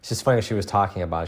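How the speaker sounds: normal talking voice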